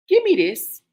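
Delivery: snatch it away with a sudden shout; sharp command. Retold or imitated. To her friend holding something dangerous, a sharp loud demand.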